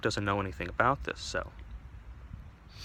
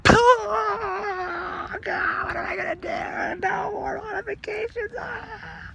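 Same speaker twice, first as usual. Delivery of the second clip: very high wailing mock cry; hammed-up sobbing; 5 dB louder, slower than usual. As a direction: bawl it out like exaggerated despair. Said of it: "he just bursts into tears".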